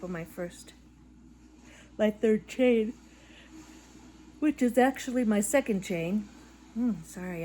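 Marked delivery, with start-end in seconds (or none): 2.0-6.9 words stretched through a yawn. Real, not acted. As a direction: talk mid-yawn. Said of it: "I'm gonna make everybody yawn".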